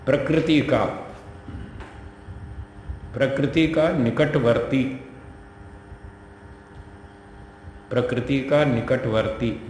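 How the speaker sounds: slow, measured dictation in short phrases; word by word, with long pauses. Real, not acted. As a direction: dictate slowly for students to write down. Dictates for writing down.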